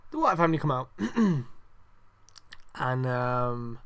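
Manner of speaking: normal talking voice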